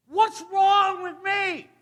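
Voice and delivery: high, slow, drawn-out wail; exasperated wail. Acted. Single comedian lamenting to the crowd, exasperated wail. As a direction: wail it in comic despair. Single man compares himself to killer.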